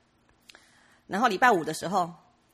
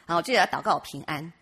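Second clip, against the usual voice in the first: normal talking voice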